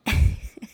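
a short breathless giggle; breathy laugh. Real, not acted. Laughs recalling fake plant.

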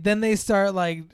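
normal talking voice

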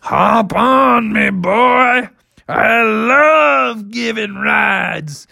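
slow, high, big creature voice; jolly triceratops. Acted. Triceratops inviting bear for a ride, hearty. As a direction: stretch the words out hugely.

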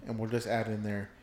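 usual speaking voice

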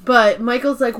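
ordinary delivery

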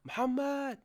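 high-pitched call across the house; parent calling out. Retold or imitated. Parent calling the child to the phone, calling out. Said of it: "And they're like".

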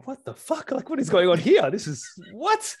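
high-pitched baffled outburst, reliving the shock; incredulous retold reaction; shocked disbelief. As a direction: act out stunned confusion, voice jumping way up. Retelling his shocked reaction.

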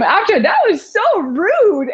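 high, drawn-out indignation; mock offended. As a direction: retell it as playfully affronted. Acting own offended reply to friend.

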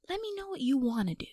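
quick whispered plea to God; hushed prayer to God. Pretend. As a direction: whisper it fast, high and pleading.

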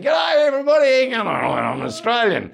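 high, hammed-up Aussie stage greeting; broad Australian accent. Comedian going out on stage to greet crowd, high broad Australian accent. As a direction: call out to the crowd in exaggerated Australian. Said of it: "I'm going out and saying".

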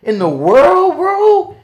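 high, loud incredulous repetition; loud disbelief. Real, not acted. Telling friends about Gretna's arrests, loudly incredulous. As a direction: hammer it home in amazement, voice climbing.

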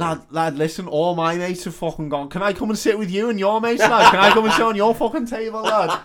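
high, loud pleading drunk, hammed up; drunk lad. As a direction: wheedle like a drunk who lost his mates. Drunk lad begging to join.

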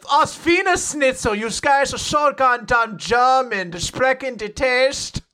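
high, slow, exaggerated German accent; overdone mock German accent; hammed-up German accent. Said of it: "what we assume Brawler sounds like when they're speaking in German".

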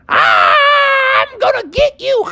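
high, drawn-out monster yell; menacing rattlesnake. Acted. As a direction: stretch it into a slow creature threat. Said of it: "and the rattler said".

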